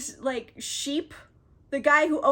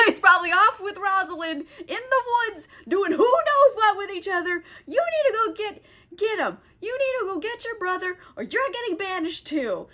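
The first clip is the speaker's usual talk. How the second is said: high, hammed-up scolding; imitating an angry ultimatum; pissed-off Duke; 7 semitones higher, 3 dB louder than usual. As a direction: rant in mock outrage, pitched up. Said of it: "He is still pissed".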